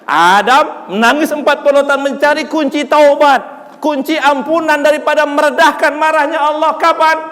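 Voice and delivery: high-pitched, ringing sermon climax; impassioned preaching. Real, not acted. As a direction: drive the question home from the pulpit. Preaches at full force. (ceramah berapi-api dengan nada tinggi)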